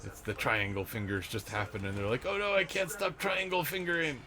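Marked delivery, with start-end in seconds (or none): 2.2-4.2 high, hammed-up dismay; comic high-pitched panic voice; mock panic. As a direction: wail it in pretend helplessness. Mocks them panicking over fingers.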